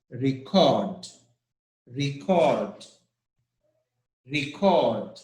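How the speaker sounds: "Record" as a verb, stressed on the second syllable CORD. Shown as a demonstration. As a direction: say "Record" as re-CORD, falling at the end. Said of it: "it's a verb, not a noun".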